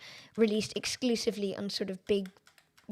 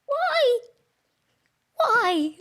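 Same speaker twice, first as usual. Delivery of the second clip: high, drawn-out lament; despairing wail; 12 semitones higher, 4 dB louder than usual. Real, not acted. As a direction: cry it out in comic despair. Despairing at the race.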